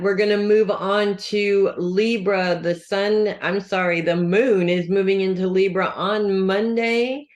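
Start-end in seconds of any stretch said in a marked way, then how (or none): none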